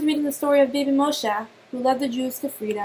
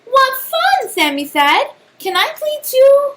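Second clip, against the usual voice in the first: put-on high, loud eager child voice; little spider begging eagerly to play; excited; 11 semitones higher, 7 dB louder than usual. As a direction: bounce with excitement to join the game.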